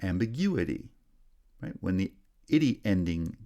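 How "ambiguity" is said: main stress on "gu" in "Ambiguity"; "Ambiguity", lighter first stress, strong third syllable, then falling; third-syllable primary stress. Shown as a demonstration. Teacher showing stress shift, third syllable strongest in "Ambiguity". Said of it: "primary stress is shifted to the third syllable".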